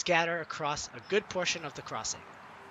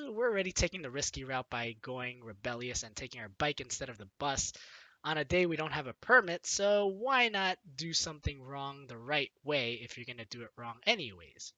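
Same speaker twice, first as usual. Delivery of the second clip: normal talking voice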